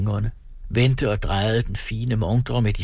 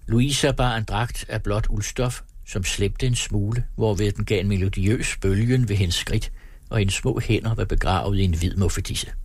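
usual speaking voice

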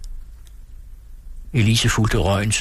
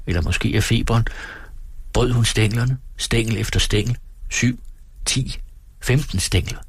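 ordinary delivery, nothing marked

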